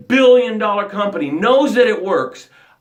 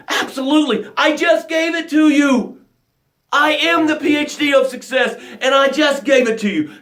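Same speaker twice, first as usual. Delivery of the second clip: shouting with fervent pride; triumphant shout; 7 semitones higher than usual. Acted. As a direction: announce it like a victory. Hypes his cure for success.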